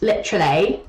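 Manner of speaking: ordinary talk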